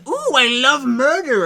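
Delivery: high, loud, slow evil glee; gleeful villain. Pretend. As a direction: relish it like a giddy killer. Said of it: "Cheerful as in".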